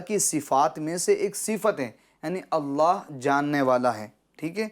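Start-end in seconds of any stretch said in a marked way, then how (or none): none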